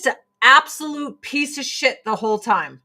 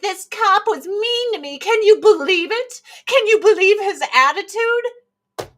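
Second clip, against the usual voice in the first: high, mocking whine about a mean cop; whiny complainer; 11 semitones higher, 5 dB louder than usual. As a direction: sneer as a self-pitying complainer.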